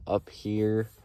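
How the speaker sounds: usual speaking voice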